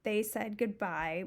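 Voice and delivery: usual speaking voice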